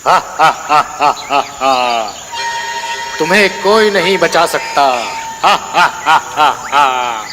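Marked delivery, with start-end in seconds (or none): 0.1-2.1 evil cackle. Acted. Demon laughs at burning man. 5.4-7.2 evil cackle. Acted. Demon gloats.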